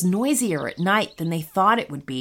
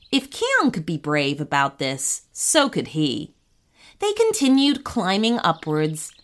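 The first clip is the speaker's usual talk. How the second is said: ordinary delivery, nothing marked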